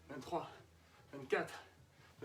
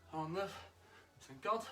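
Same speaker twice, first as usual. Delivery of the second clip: usual speaking voice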